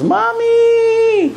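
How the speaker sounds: a high, loud, ringing cry of "Mommy!"; child's loud high scream. Retold or imitated. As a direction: call out for a lost mother, loud and high. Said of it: "he screams".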